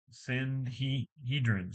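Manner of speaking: "Sanhedrin" sounded out slowly, syllable by syllable; careful pronunciation. Shown as a demonstration. Reader working out "Sanhedrin" aloud, slow and quiet. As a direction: spell out "Sanhedrin" slowly as san-HEED-rin. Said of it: "how do I say that?".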